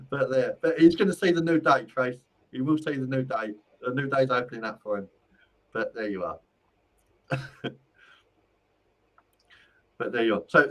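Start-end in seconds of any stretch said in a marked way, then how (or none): none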